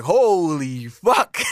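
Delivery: long drawn-out astonishment; amazed. Retold or imitated. Reacting to Qatar's strict rules, drawn-out amazement. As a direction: stretch each word in delighted disbelief.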